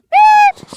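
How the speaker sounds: a sharp, very high, loud vocal beep; imitated timer beep. At the range, mimicking the timer before the drill: high loud beep. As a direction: squeal it out like a shot timer's start tone.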